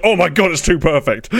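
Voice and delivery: loud, high and thrilled; excited. Real, not acted. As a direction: gush it out loud, delighted.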